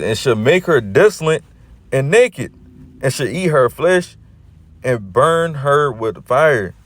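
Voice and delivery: slowed, booming verse read with weight on each word; dramatic scripture reading. Shown as a demonstration. Preacher reading the prophecy to his listeners, slow and emphatic.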